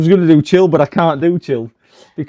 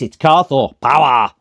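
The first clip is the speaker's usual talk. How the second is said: a hint of a louder, punchier voice; imitating Carthor; 5 dB louder than usual. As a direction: lightly do Carthor's voice, a bit louder. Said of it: "I think Carthor talks like this".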